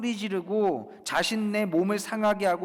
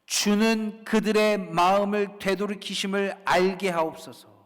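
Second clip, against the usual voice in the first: raised, loud solemn petition; emphatic prayer; 4 semitones higher, 6 dB louder than usual. Acted. Preacher quoting Elijah's prayer, emphatic petition. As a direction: press every phrase of the prayer home. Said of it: "뭐라고 기도합니까?".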